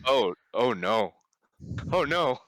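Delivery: growing horror, building up; mounting dread. Retold or imitated. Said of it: "they were like".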